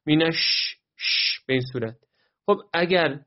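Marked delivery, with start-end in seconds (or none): 0.1-1.4 مینش with a long, airy ش; spreading sh. Shown as a demonstration. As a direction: let air swirl in the mouth on ش of مینش. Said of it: "در فضای دهان میپیچه". (شینِ مینش با تفشی)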